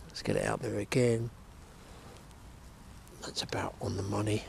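hushed whisper to himself. Real, not acted. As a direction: breathe it out under his breath. Whispered to himself while casting.

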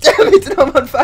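high laughing cry of dismay; laughing shriek. Real, not acted. Player burning, laughing shriek.

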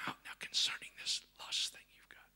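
hushed, discreet whisper; confidential whisper. Acted. God privately convicting someone, in a whisper. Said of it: "he just whispers".

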